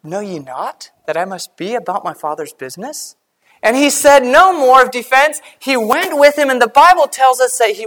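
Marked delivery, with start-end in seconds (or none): 0.0-3.2 quietly respectful, much softer; a soft, lower, respectful reply from the boy Jesus; simple and respectful. Retold or imitated. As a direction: answer gently, without defending yourself. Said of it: "He answered simply and respectfully".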